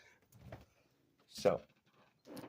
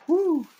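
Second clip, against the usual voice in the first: high, loud whoop of glee; a loud, high victory whoop; excited whoop; 11 dB louder than usual. Real, not acted. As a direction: let out a triumphant cheer. Cheering after insulting Blondie.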